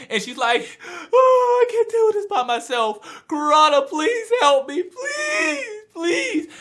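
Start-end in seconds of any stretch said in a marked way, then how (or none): 1.1-6.5 hammed-up high, wailing plea; mock crying. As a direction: wail and beg in a very high, tearful imitation. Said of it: "she's crying".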